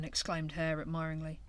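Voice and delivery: plain reading voice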